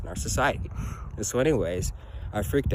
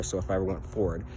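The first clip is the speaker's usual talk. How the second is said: ordinary talk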